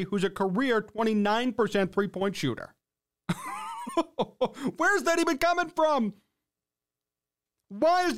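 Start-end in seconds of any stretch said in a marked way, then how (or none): 3.3-4.7 high burst of incredulous laughter; real laugh. Real, not acted. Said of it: "I'm laughing 'cause it's comical".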